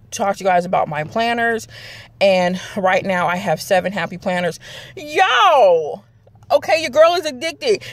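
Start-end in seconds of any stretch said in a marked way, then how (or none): 5.0-7.8 high, gleeful outburst; excited confession. Real, not acted. Vlogger confessing planner addiction to viewers, excited. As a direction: burst out with a playful confession.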